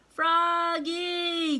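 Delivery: high, loud call across the house. A narrator's reading. Father calling his son from afar, loud. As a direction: call for him from the other room. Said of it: "called his father".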